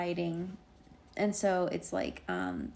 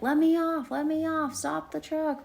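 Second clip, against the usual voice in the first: high, louder at the start; mock urgent demand; 10 semitones higher, 5 dB louder than usual. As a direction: imitate pleading to be let out. Acting out demand to stop.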